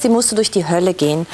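ordinary talk, nothing marked

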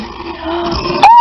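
sharp loud gasp of delight. Real, not acted. Gasp at seeing the new plush pet in the box. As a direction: gasp as the toy comes into view.

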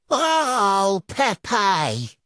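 ordinary talk

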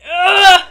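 pained groan before eating; dreading the bite. Real, not acted. Bracing to eat hot chocolate.

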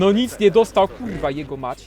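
angry outburst. Real, not acted. Hit misses, player erupts.

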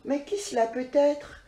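ordinary delivery

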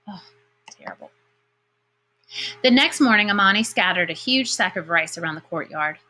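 ordinary delivery, nothing marked

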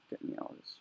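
breathless whisper in panic; panicked whisper. Real, not acted. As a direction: whisper it, desperate to escape. Panicked whisper under pressure.

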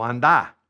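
"anda" stressed on the last a; final-syllable stress. Shown as a demonstration. Teacher showing the command for go, stress on the end of "anda". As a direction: put the weight on the second syllable of "anda". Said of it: "with an accent on the A".

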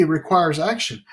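ordinary talk, nothing marked

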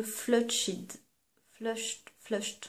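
slow, tentative English word in a French accent. Real, not acted. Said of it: "Désolée pour mon accent".

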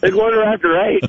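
hammy astonished repeat; overplayed disbelief; shocked. Staged spit take, overreacting.

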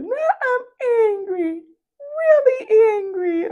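hammed-up toddler fury, high and whiny; imitating a four-year-old saying she's angry, in a tiny high voice; little girl's angry voice. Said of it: "Talk about".

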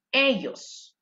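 ordinary talk

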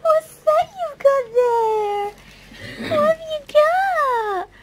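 high, loud, slow coo to a pet. Real, not acted. Cooing baby talk to pet.

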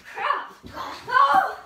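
high, loud mock panic; panicked yell. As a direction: yelp as if the zombie got you. Zombie attacks, pretend panic.

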